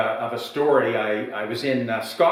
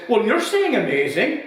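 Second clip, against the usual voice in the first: put-on old man scoffing; 8 semitones higher, 3 dB louder than usual. Old man repeats the word.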